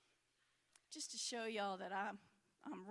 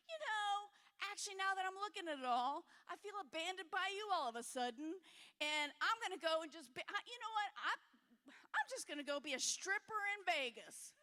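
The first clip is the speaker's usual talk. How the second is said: bitter outburst at God; 7 semitones higher, 3 dB louder than usual. Retold or imitated. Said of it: "That's how angry I got at God".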